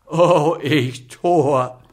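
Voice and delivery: loud lamenting cry; apothecary lamenting; wailing. A narrator's reading. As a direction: wail at your own folly. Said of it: "jammerte der Apotheker".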